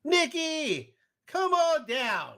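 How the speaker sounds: high, drawn-out announcer call; game-show call-out. Pretend. Host calls the guest to join the show, mock announcer call. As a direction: summon a contestant in a high, stretched voice.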